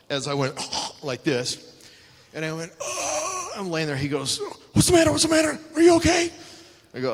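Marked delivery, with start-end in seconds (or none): 2.8-3.5 pained cough gasp. Retold or imitated. Rib broken, retold gasp. 4.7-6.3 high, loud, frantic questions; panicked Vader. Retold or imitated. Vader fussing over the wrestler he just hurt.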